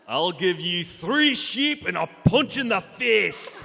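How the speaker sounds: slow, shouted angry Scottish threat; angry Scottish accent. Pretend. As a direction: growl it out in a furious Scottish accent. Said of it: "say really angrily".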